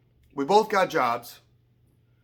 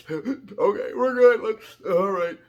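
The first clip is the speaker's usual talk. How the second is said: mock pulling it together; 7 semitones higher, 7 dB louder, slower than usual. Regaining composure after crying.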